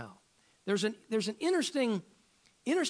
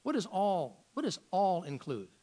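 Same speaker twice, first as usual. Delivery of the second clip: ordinary talk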